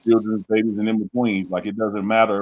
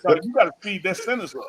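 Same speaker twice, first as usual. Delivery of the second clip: higher, laughing tease; laughing mock advice; 9 semitones higher than usual. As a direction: laugh while telling him to hurry. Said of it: "I was like".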